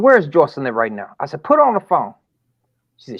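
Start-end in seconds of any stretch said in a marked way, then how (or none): 0.0-1.1 demanding father. Retold or imitated. Father demands daughter on phone. 1.4-2.1 higher and louder, demanding; angry demand. Retold or imitated. Father to roommate, louder, higher angry demand.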